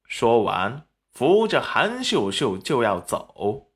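plain reading voice

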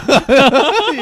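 loud laugh. Real, not acted. Laughs loudly.

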